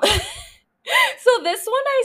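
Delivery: slow, high, giggly words through laughter; real giggling confession; laughing through it. Real, not acted. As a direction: let the laugh spill into the words.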